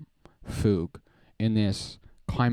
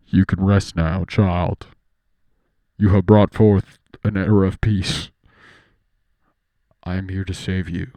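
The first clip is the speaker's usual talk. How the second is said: low, loud, solemn god voice; deep divine Foog; 4 semitones lower, 9 dB louder than usual. Acted. Foog blessing Joshua from above, low and solemn. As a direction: proclaim it like a god granting peace. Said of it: "I figure out how to lower my voice".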